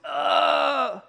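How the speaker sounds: long high "ugh" of pain; hammed-up wounded groan; mock dying groan. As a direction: groan like a skit's accident victim. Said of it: "one guy's bleeding out".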